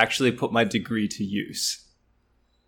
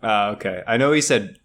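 ordinary talk

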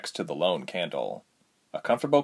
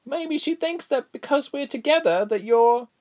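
put-on little girl voice.

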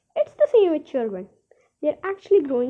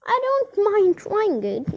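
hesitant high voice; 8 semitones higher, 3 dB louder, slower than usual. Acted. Doubtful Miss Silver.

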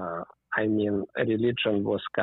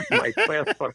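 words bubbling through high-pitched laughter; laughing; 12 semitones higher, 4 dB louder than usual. Real, not acted. Guest joking about his giveaway passport, laughing.

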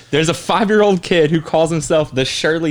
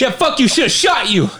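mock angry; 7 semitones higher than usual. Joking mock insult.

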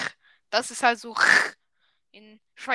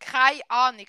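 louder Swiss German demo; 7 dB louder than usual. Shown as a demonstration. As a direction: say it firmly in Swiss German dialect. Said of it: "heißt keine Ahnung".

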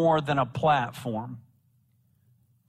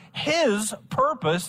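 "his" held out and punched high; raised, deliberate emphasis on "his"; stress on his; 8 semitones higher, slower than usual. Shown as a demonstration. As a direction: hit "his" as the key word.

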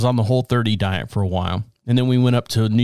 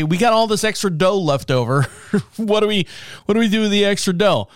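lightly voiced higher-pitched pizza maker; pizzeria staff; 7 semitones higher than usual. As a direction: act out puzzled kitchen staff, pitched up. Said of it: "sometimes they'll just say".

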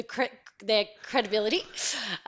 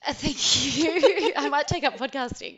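laughing thanks, louder and higher; 7 semitones higher, 7 dB louder than usual. Real, not acted. Thanking a cohost for the segue compliment, laughing. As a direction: thank them through real laughter.

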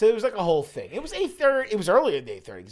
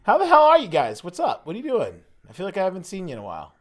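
high, loud, fast hammed-up hello; mock excited greeting; 5 semitones higher, 5 dB louder, faster than usual. Host greeting friends with fake surprise, hammed-up excitement. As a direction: burst in like you haven't seen them in ages.

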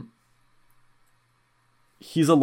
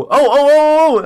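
much higher and louder, drawn out; sudden thrilled realization; excited oh oh; 13 semitones higher, 10 dB louder, slower than usual. Real, not acted. Suddenly remembering a good topic.

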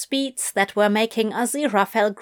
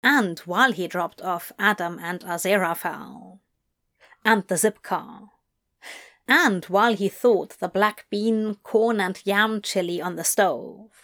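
ordinary delivery, nothing marked

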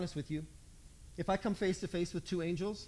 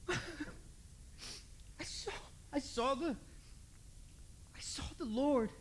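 soft, high, halting disbelief; overwhelmed, faltering; 5 semitones higher, 10 dB quieter, much slower than usual. Acted. Mary at the door with her news, faltering and hushed. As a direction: barely get the words out, restarting softly.

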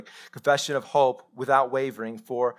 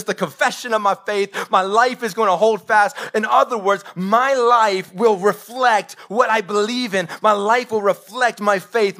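higher and louder, driving the sermon home; full-force preaching; 6 semitones higher, 5 dB louder than usual. Acted. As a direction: preach it like Paul fighting the fight. Preaching Paul's words at full force.

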